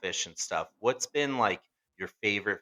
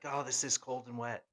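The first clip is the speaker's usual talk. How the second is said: higher, softer imitation of a miserable crewmate; mock misery; 5 semitones higher, 7 dB quieter than usual. Imitating the crewmate five minutes later, mock misery. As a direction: complain feebly, in mock self-pity.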